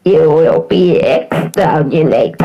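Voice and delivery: slow, loud robotic Dalek through a voice filter; mock Dalek menace. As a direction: threaten like a Dalek. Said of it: "sound like a Dalek".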